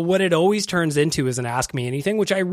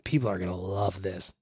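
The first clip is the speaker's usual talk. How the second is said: smug ad executive; 7 semitones lower, 8 dB quieter than usual. Retold or imitated. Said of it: "put that on air and going".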